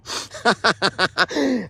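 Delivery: a short high embarrassed laugh. Real, not acted. Vlogger laughing off his own tears. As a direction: laugh it off, a bit sheepish. Embarrassed after getting emotional.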